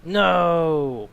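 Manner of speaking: long, loud falling cry; drawn-out loud protest; dismayed shout. Real, not acted. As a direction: groan it out loudly in dismay. Dismay at seeing two doors.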